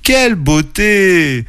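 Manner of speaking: high, long drawn-out marvel; exclaiming in wonder. A narrator's reading. Oreille sees the crystal lake, exclaiming in wonder. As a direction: stretch the words out in high-pitched awe. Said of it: "s'exclama Oreille".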